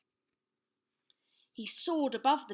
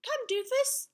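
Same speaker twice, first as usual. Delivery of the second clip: put-on very high, loud boy knight; little knight; 6 dB louder than usual. A narrator's reading. Young knight summons his steed, high and bold. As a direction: call the horse in a high child's voice. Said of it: "said Sir Scallywag".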